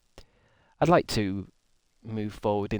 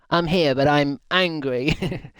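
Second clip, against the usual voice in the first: higher, louder, very slow put-on grumble; mock sulky child; 5 semitones higher, 4 dB louder, slower than usual. Streamer mimicking a chatter, sulky and much higher. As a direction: pout it out like a cross little kid.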